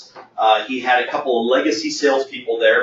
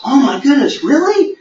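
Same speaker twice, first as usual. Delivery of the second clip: high, pleased gasp of disbelief; delighted surprise; 12 semitones higher, 4 dB louder than usual. Retold or imitated. Young employee told she may leave early, high delighted surprise. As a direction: sound thrilled by good news.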